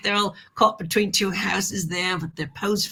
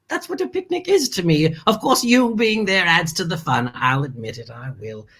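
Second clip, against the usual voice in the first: normal talking voice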